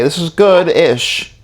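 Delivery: drawn-out verdict. Retold or imitated. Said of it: "I'm like".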